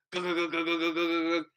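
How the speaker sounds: rapid glugging noises; imitated gulping. Telling how he pounded the sweet tea, imitated gulping. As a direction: mimic chugging a drink down.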